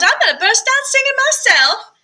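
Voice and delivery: high, bright mock threat; playful high tease. Mama teasing her daughter, playful high threat.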